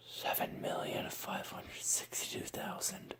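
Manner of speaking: whisper in right ear. Shown as a demonstration. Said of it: "Next side".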